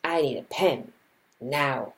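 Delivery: "I need a pen, now" with a firm, falling "now"; a mock-angry order, lightly done; "I need a pen, now" as a curt demand; mild angry demand. Shown as a demonstration. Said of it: "if I say it in an angry voice".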